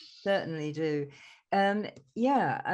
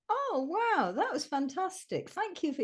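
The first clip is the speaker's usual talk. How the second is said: high-pitched awestruck gushing; acted delight; 9 semitones higher than usual. Two friends thanking a singing robin, acted delight. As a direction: gush your thanks, pitched well up.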